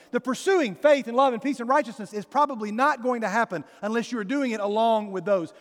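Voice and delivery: ordinary delivery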